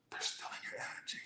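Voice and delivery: breathy hushed whisper. Acted. Reading tarot to viewers, a hushed whisper. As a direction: lean in and whisper it.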